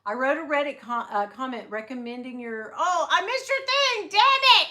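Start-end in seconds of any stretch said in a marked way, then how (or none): 2.8-4.7 high, loud dismayed outburst; real excited dismay. Real, not acted. Vlogger reacting to a missed chat message, high and loud. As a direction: blurt out frustration at missing it.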